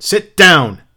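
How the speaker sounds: high, loud order; shouted command. A narrator's reading. Montag ordering three women back down, shouted command. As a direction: bark it out forcefully.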